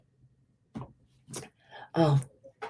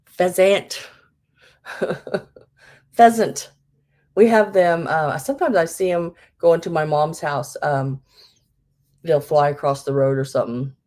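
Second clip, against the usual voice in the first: ordinary talk, nothing marked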